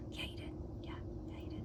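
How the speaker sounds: soft unvoiced whisper. Real, not acted. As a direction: whisper it aside to the camera. Aside whispered to camera.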